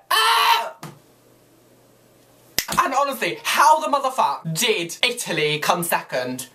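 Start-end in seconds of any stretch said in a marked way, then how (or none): 0.1-0.7 high, loud mock screech of a bad singer. Mocking the winning singer's voice, shrill mock screech. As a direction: shriek like a cat being murdered. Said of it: "her voice was like".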